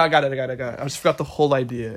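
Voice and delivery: ordinary talk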